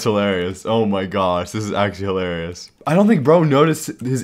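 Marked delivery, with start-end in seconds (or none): none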